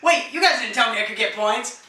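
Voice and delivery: ordinary talk, nothing marked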